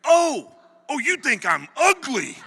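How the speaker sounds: high, loud outraged shout; drill sergeant shouting. Retold or imitated. Drill sergeant shouts at a frightened recruit, loud and outraged. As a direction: blast it at the recruit. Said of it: "He's like".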